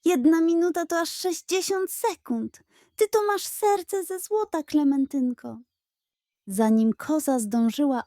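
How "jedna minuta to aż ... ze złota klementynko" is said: high, gushing flattery; butter her up sweetly; flattering pug. Acted. Pug flattering the goat for the minute, high.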